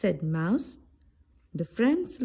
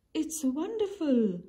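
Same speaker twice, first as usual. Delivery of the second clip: cheeped; 8 semitones higher than usual. A narrator's reading. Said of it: "Bird cheeped".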